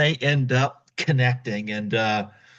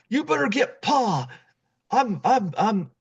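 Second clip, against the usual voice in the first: put-on dying kid voice; 9 semitones higher than usual. Dying boy retold.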